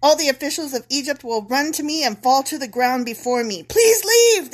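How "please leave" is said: high, loud desperate plea; begging. Acted. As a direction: beg them to go. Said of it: "they will beg".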